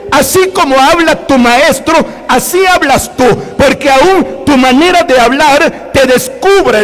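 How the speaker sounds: preaching with rising fervour; fervent preaching. Acted. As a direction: proclaim each line to the congregation. Preaching at full force.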